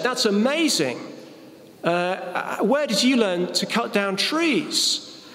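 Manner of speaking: the boss can't believe his eyes; mock astonished boss asking where he learned; amazed boss. Joke, boss amazed by applicant.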